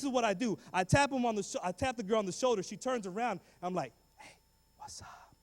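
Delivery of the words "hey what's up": whispered come-on; hushed flirting.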